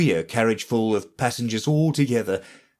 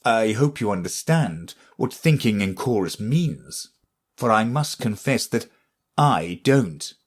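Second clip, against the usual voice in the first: plain reading voice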